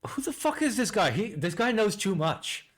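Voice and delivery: high-pitched, incredulous outburst; stunned disbelief. Real, not acted. Streamer reacting to the chip video, stunned high disbelief. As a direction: blurt it out, shocked at how much he knows.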